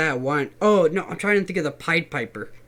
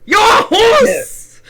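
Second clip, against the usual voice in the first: wailing; 14 semitones higher, 9 dB louder, much slower than usual. Acted. Acted kingsman wailing.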